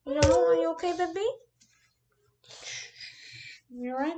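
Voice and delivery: high cooing question. Real, not acted. Checking on her baby.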